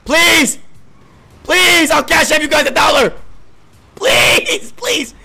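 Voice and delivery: loud, high begging; desperate pleading. Real, not acted. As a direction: beg at the top of your voice. Begging other players for help.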